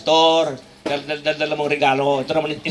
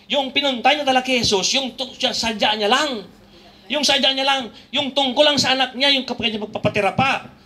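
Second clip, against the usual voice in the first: preaching; 8 semitones higher than usual. Acted. Preaching at higher pitch.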